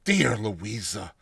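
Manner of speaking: ordinary delivery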